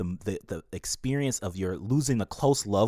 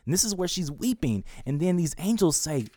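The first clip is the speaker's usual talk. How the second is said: usual speaking voice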